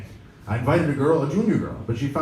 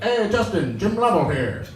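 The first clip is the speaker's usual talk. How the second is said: higher, louder, crisp announcer-style Jim Lovell; Jim Lovell impression; 9 semitones higher, 3 dB louder than usual. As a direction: imitate a sharp college-football analyst voice. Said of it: "and he sounds like".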